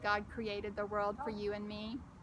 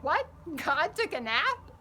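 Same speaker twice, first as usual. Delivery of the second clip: squeal; 5 semitones higher, 5 dB louder than usual. Acted. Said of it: "Bo squealed".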